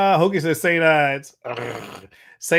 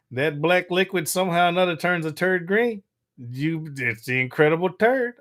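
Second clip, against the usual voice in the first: ordinary talk, nothing marked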